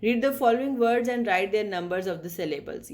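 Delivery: ordinary talk, nothing marked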